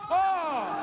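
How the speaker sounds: usual speaking voice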